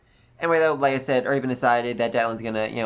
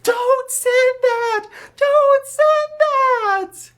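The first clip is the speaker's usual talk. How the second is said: shrill, far higher, loud slow shrieks; panicked screaming; 7 dB louder, much slower than usual. Retold or imitated. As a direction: scream it in horror, dragging each word. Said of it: "her retainers are screaming in the background".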